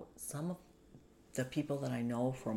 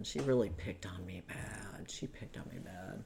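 ordinary talk

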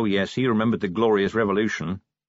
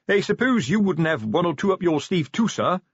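higher, eager sergeant voice, pleading a little; hoping the boss has a trick; openly hopeful sergeant; 8 semitones higher than usual. Acted. The sergeant openly hopes his commander has a plan, high and eager. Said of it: "the hope now naked and unashamed".

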